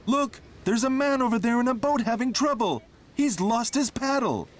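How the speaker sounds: high, fast, urgent character voice; alarmed tractor voice. Acted. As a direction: point it out in a hurry.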